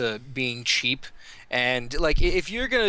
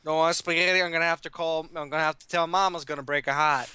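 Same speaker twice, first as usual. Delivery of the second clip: lightly voiced Italian mobster accent; a hint of an Italian wiseguy. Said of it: "You almost lost your accent there".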